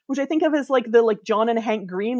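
ordinary talk, nothing marked

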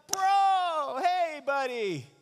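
high, slow, sugary buddy voice; fawning crowd. Acted. Crowd turning friendly once Jesus calls, high fawning voice. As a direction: suck up to him all of a sudden.

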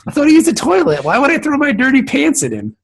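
high, baffled questioning; incredulous. Real, not acted. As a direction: sound totally confused by it.

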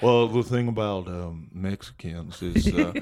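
easygoing low Texas twang; Texas accent. Acted. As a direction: play a homespun Texan, voice dropped low. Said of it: "that, the Texas accent".

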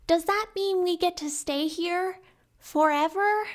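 put-on high, eager child's voice; little girl voice. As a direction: ask like a hopeful little girl. Child Riona asks.

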